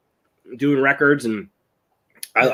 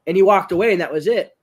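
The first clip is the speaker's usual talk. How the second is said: normal talking voice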